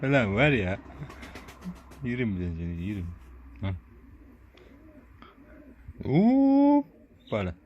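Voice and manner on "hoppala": high, loud, long drawn-out hoppala; playful hoppala. Pretend. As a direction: stretch it out like lifting a baby. Lifting the baby animal up playfully.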